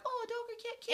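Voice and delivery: very high, sweet cooing voice; cooing over a pet. Retold or imitated. People gushing over a cute pet, high cooing. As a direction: gush like you've just seen a puppy. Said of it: "people are like".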